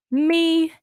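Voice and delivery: imitating an eager girl, one long high-pitched word; eager chiming in. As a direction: pipe up like everyone wants to be picked. Imitating girls eagerly volunteering themselves.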